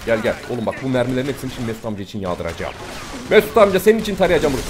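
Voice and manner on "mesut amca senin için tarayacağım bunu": loud and higher, fired up; excited shout. Real, not acted. Dedicating a burst of fire to Mesut amca, excited shout. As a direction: bellow it over the gunfire.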